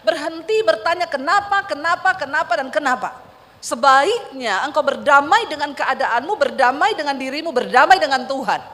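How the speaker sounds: loud, urgent sermon exhortation; forceful preaching. Retold or imitated. Preacher urging the grieving congregation, full-force sermon. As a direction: drive each berdamai home from the pulpit. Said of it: "Saya putar satu khotbah Ibu".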